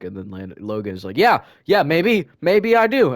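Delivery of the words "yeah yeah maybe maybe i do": high, loud, slow defiance; imitating someone mad. Classmate doubling down before the teacher, imitated loud anger. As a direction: act out a worked-up classmate, drawing words out loudly. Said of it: "getting really mad".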